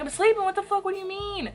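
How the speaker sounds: high, loud cranky outburst; acted-out cranky protest from bed. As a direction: whine angrily at whoever woke you. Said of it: "I was cranky as hell".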